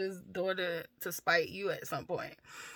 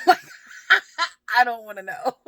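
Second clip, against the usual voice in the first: laughing; 5 semitones higher, 12 dB louder, faster than usual. Real, not acted. Mother recalling her daughter's inappropriate stories, laughing. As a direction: talk through bursts of real laughter.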